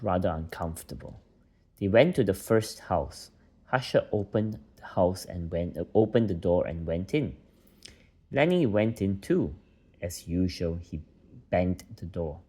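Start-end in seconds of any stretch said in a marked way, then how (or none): none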